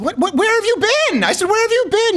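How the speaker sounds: high, exasperated disbelief; incredulous. Retold or imitated. Telling gym buddy about the Texas case, incredulous. Retelling own incredulous reply.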